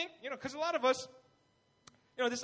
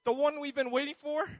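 urgent question; 4 semitones higher, 8 dB louder than usual. Retold or imitated. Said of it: "John the Baptist sent people to Jesus like".

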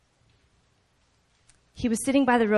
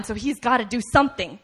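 ordinary talk

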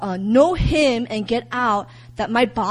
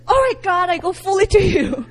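laughing mock prayer; 7 semitones higher, 5 dB louder than usual. Mock surrender prayer to God.

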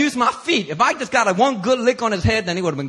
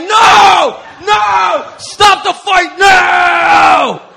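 high, loud, slow yell; crowd yelling; 11 semitones higher, 9 dB louder, much slower than usual. Retold or imitated. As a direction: crowd stopping the fight. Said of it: "They were like".

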